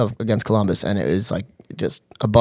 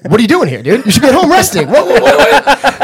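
loud, high-pitched starstruck babble; freaking out; 12 semitones higher, 5 dB louder than usual. Retold or imitated. Fan to hockey player on the slope, freaking out. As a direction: re-enact losing it at meeting a star. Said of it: "I started freaking out".

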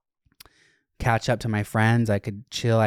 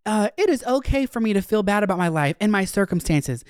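much higher earnest mock voice; high imitated heartfelt vent; imitating a venting girl; 10 semitones higher than usual. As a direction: parody a girl airing her feelings. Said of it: "they're like".

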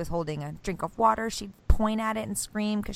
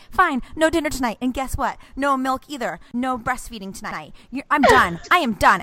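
higher and louder, fed-up lecturing; mock stern mom; 6 semitones higher, 7 dB louder than usual. Mom retells snapping at her toddler, fed up. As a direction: scold a one-year-old like a big kid. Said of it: "talking like she's, like, a really big kid".

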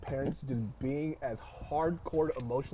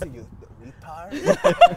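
very slow, louder insult, laughing through it; mock insult; 4 semitones higher, 10 dB louder, much slower than usual. Imitating dad insulting him, laughing and very slow. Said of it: "Or he goes".

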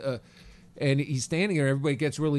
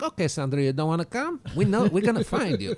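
louder, higher broken-English warning; the captain half-threatening over the speakers; mock captain accent; 7 semitones higher, 6 dB louder than usual. Captain warns the missing passenger they will find her, mock accent.

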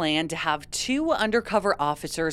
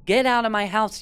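mild mock shout, a bit higher; half-acted yell at the cops; lightly imitating an angry shout at police; retold shout; 5 semitones higher, 4 dB louder than usual. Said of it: "Antolin shouted at the police".

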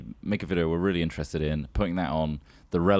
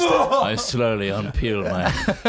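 mock seductive narration; 3 semitones higher, 6 dB louder, much slower than usual. Mock seductive sex description.

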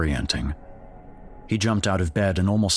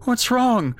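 put-on much higher small boy's voice; scared crying child. Jake asking his parents what's wrong, scared child. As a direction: ask like a frightened little boy.

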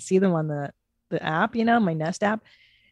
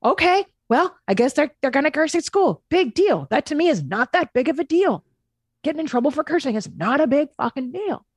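high, hyped-up shrug; Gary impression; 7 semitones higher than usual. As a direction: brush it off like a hyped-up guru. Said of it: "And he's like".

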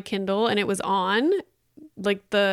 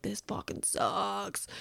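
muttered complaint; 12 dB quieter, slower than usual. Retold or imitated. Complaining mid workout.

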